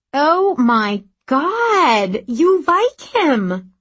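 loud, slow, drawn-out teasing squeal; excited. Acted. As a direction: gleefully tease her about a crush. Friend teasing about crush.

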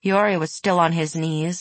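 usual speaking voice

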